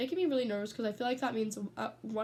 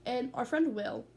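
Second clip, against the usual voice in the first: ordinary delivery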